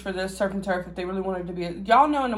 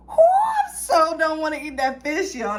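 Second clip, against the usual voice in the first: whiny dread; 7 semitones higher than usual. Real, not acted. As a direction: whine it like you can't bear to eat it. Dreading eating fish on camera.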